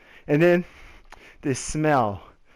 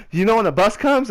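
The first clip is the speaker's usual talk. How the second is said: excited, breathless question; 6 semitones higher than usual. Retold or imitated. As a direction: ask it all wound up. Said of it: "really excited".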